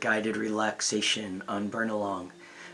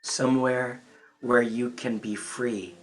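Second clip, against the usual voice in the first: guided relaxation; 6 dB quieter than usual. Real, not acted. Inviting listeners to relax and turn inward, guided relaxation. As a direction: guide them gently into calm, phrase by phrase.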